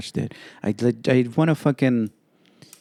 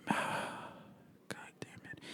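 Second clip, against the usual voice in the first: sigh, then whisper the curse; 21 dB quieter, faster than usual. Real, not acted. Host annoyed at his sore pinky, sighing a whispered curse. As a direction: breathe out a curse under the breath.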